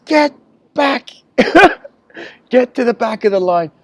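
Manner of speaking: high, loud "get back" with a laugh inside; angry shout. Retold or imitated. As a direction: bark him back to the line. Master archer dismisses smart answer.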